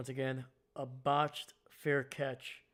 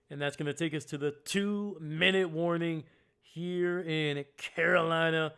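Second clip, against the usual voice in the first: loud, raised sportscaster call building on the run; punchy live football commentary; excited play-by-play; 4 semitones higher, 8 dB louder than usual. Real, not acted.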